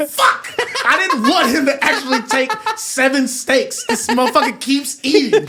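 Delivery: mock annoyed restaurant staff. Restaurant staff cursing the big eater, hammed-up and laughing. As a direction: ham up the staff's dismay. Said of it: "They go".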